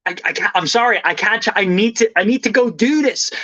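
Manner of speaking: stammering, apologetic urgency, pitch climbing; in a rush. Retold or imitated. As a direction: act out trying to break away from chit-chat. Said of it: "I am in a rush here".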